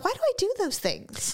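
high-pitched mock exasperation; retold inner wail at oneself; baffled self-questioning. Retold self-reproach in her head.

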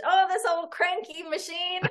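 put-on fussing over a balky engine; feigned engine trouble. Player deceiving the gunman about the truck, feigned fretting.

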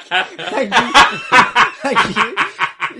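laughing through sarcastic thanks. Retold or imitated.

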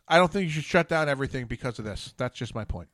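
ordinary talk

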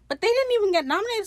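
very high, fast outburst of disbelief; indignant protest. Real, not acted. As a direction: protest the snub in a high rush. Protesting an awards snub.

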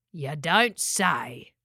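low, very drawn-out character voice; droll pelican. A narrator's reading. Gary answers Spike on her name, droll pelican drawl. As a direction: stretch it out slowly and drily.

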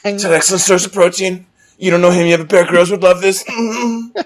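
high, loud put-on bravado; acting tough, tearfully. Friend joining the apple bit, mock tearful bravado. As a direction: play along as the brave hurt boy. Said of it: "Trying to act tough, eating the apple".

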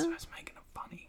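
whispered aside, barely voiced; whispering. Real, not acted. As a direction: whisper it under your breath. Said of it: "Well, stop".

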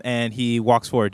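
usual speaking voice

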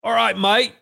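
a silly British greeting; stupid British accent. Shown as a demonstration. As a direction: put on a hammed-up British accent. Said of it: "the stupid British accent for the night".

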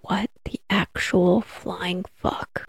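slow, quiet, appalled disbelief; stunned disbelief. Retold or imitated. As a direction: say it slowly and softly, dumbfounded. Stunned reaction to needle count.

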